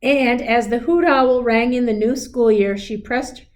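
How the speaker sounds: gentle storybook read-aloud; a teacher reading a picture book aloud; reading to children. A narrator's reading. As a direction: read it like a bedtime story to a class.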